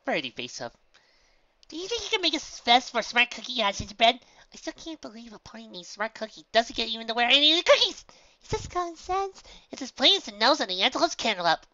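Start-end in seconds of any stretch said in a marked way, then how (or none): 1.7-11.7 high, loud, excitable chatter; bubbly pony. Acted. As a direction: squeal out a silly rant. Pinkie figure rambles excitedly.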